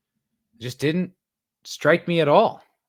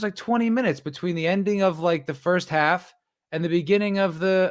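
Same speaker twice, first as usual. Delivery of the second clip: ordinary talk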